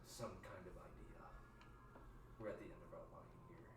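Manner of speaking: soft, tired plea, voice worn from crying; a quiet, spent, teary plea; exhausted. Acted. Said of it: "Elodie's exhausted voice says".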